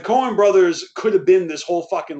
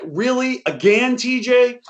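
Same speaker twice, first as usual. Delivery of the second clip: put-on a touch of the fed-up girlfriend, higher; lightly voiced girlfriend, pitched up in disbelief; exasperated girlfriend; 7 semitones higher than usual. Girlfriend tired of the movie on repeat, mild exasperated voice.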